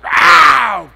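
loud, high "Ow!" as if punched; mock yell of pain. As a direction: shout the pain, hammed-up. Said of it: "Goes out".

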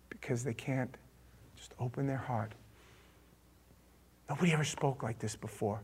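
very soft, low near-whisper; solemn hush. Real, not acted. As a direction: say it quietly, with weight. Drops to a hush.

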